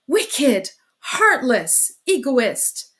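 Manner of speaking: loud, angry outburst; furious cry. A narrator's reading. Sister crying out at departing brother, loud and angry. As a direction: hurl each word at him. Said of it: "cried Dunya".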